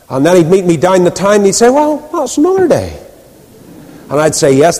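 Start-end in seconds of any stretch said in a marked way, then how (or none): 1.7-2.9 higher-pitched imitation of a Ballymena man; Ballymena accent. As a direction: mimic the Ballymena lilt, pitched up high. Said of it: "in his Ballymena accent".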